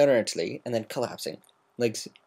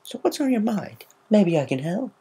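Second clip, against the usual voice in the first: high, light offer of help; a bright helpful ask; perky offer; Gilda voice; 13 semitones higher, 3 dB louder than usual. A narrator's reading.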